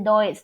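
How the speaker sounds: DOES read as written, "DO-es"; spelling pronunciation. Shown as a demonstration. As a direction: sound out the letters of DOES, like "doys". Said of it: "Se escribe does".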